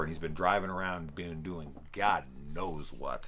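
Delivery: ordinary talk, nothing marked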